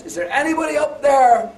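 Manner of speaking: high, loud call for help upward; desperate shout. Retold or imitated. Man hanging off a cliff calls upward, high loud shout. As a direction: yell up from the cliff for anyone. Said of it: "and he shouts".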